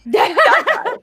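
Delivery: high laugh breaking into the words. Real, not acted. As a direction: giggle through it. Laughs mid-sentence.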